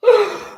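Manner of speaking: short high-pitched laugh; real squeal of laughter. Real, not acted. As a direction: let out one last high laugh. Reacting to his own story.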